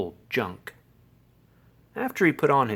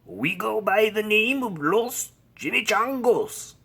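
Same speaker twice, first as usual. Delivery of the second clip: high, emphatic Chihuahua gang leader; growled; 9 semitones higher than usual. A narrator's reading. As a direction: announce the gang in an accented character voice. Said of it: "growled Don Diego".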